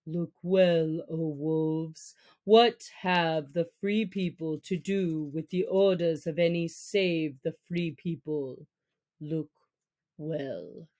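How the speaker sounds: low, stern pack leader; measured, deep command to the wolves; Akela. Acted. Leader wolf warning the free people, low and stern.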